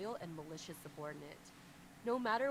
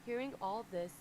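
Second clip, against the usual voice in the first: ordinary delivery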